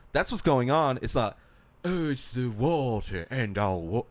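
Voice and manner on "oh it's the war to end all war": slow, lofty mock soldier line; lightly hammed grand pronouncement, slow; slow mock-poetic soldier, not yet British. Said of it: "Oh, no, he wasn't British".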